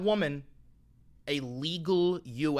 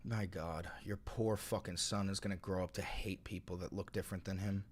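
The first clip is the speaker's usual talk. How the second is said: much lower and far softer, under the breath; quiet mutter; 11 semitones lower, 18 dB quieter than usual. Real, not acted. Streamer muttering insults at chatter Vincent after the rant, low mutter. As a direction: spit it out barely aloud, disgusted.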